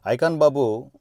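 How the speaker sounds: loud, scornful hail of the nickname; sarcastic call. Real, not acted. Vlogger taunting the star directly, loud sarcastic call. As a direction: call him out by his nickname with contempt.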